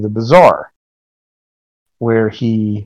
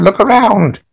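put-on very old woman; 10 semitones higher, 4 dB louder than usual. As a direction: settle back down, still hag-like. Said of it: "very old woman".

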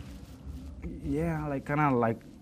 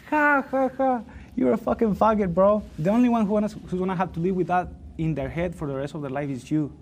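mocking taunt; 5 semitones higher than usual. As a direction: laugh in his face, then jeer at him. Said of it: "And then I was like".

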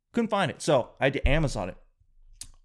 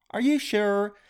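put-on Southern accent; 6 semitones higher than usual. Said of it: "they're always a Southern accent".

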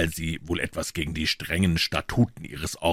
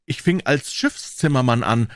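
higher-pitched eager pawnbroker; Wilson's voice; 7 semitones higher than usual. A narrator's reading. Wilson, the pawnbroker, answering Holmes, in a higher voice. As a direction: pitch him up as the earnest tradesman.

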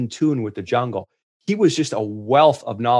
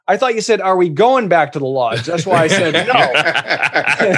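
higher, laughing through the words; laughing explanation; 5 semitones higher, 4 dB louder than usual. Retold or imitated. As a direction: laugh it off, slowing at the end. Guide laughing off the misunderstanding.

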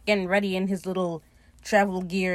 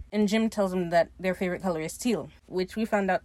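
normal talking voice

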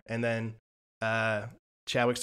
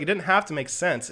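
ordinary talk

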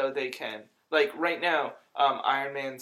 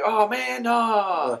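loud, high comic wail; mock dismay, pitched right up; hammed-up dismay; 7 semitones higher, 6 dB louder, much slower than usual. Friend acting out an excited retelling, hammed-up dismay. Imagined retelling by Michael Pena.

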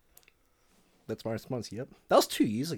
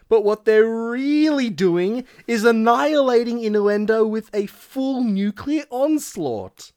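louder, higher, slowing on the final words; building climax; 5 semitones higher, 4 dB louder than usual. Acted. Podcaster capping the list of innuendo jokes, grandiose build. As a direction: build to a grand overblown finish.

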